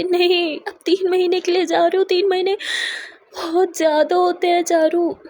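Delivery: high, loud tearful protest; crying protest. A narrator's reading. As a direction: wail that three months is too long. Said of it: "Akshu बहुत ज़्यादा रोने लगती है". (रोते हुए ऊँची आवाज़ में)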